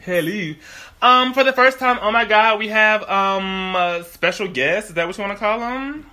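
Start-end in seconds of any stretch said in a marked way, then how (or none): 1.0-4.9 slow, drawn-out, louder reveal; announcer voice. Acted. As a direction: build suspense for the guest. Dramatic guest announcement.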